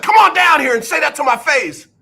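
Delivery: high, heated dare, playfully acted; mock challenge. Coach challenging the fan, mock heated dare. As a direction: dare him to come down, hammed-up. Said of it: "Bill O'Brien finally said".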